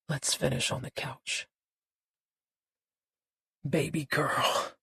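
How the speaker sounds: a lightly voiced low, slow man; a hint of a smooth older man; Buddy's voice. A narrator's reading.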